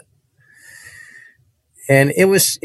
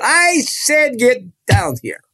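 commanding preacher; 13 semitones higher, slower than usual. Retold or imitated. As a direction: order the boy down, no arguing. Preacher orders boy down.

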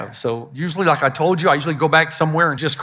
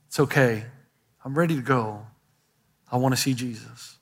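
soft, low and gentle; a dying believer's calm acceptance; peaceful dying words; 6 semitones lower, 6 dB quieter than usual. Retold or imitated. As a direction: say it quietly, at peace. Dying Christians' words quoted.

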